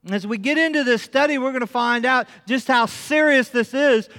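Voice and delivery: high, loud preacher's build; preaching. Acted. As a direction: preach with rising fervor. Preaching at full force.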